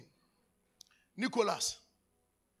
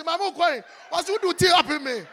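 high, loud alarmed cry to mama; startled outcry; 8 semitones higher, 10 dB louder than usual. Retold or imitated. Waking from a dream, crying to mama loudly. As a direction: yell it half-waking in alarm. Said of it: "me say".

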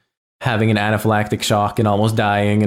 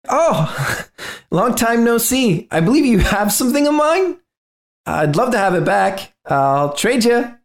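friendly intruder; 11 semitones higher than usual. Acted. Intruder offering to trade Robert for his book, over-friendly. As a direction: draw it out high, with a small laugh. Game master voices Atlas.

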